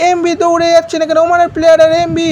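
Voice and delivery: rising to a long, shouted goal call; frantic football commentary. Real, not acted. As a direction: yell the name over and over, then hold the goal.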